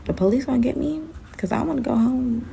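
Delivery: little voice, acted out softly; small timid voice. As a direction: quietly plead to go home. Acts out own timid worry.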